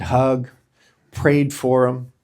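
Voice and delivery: normal talking voice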